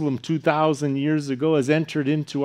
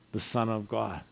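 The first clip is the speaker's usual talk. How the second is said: ordinary talk, nothing marked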